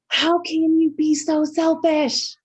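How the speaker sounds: high, loud, drawn-out mock reprimand; retold telling-off, each word pressed slowly; imitated scolding. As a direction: scold like an outraged grandma. Grandma scolds child she grabbed.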